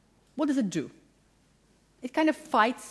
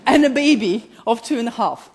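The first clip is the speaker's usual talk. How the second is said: louder, laughing through the words; 9 dB louder than usual. Real, not acted. Mother of five reveals a baby, laughing. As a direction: laugh out the punchline.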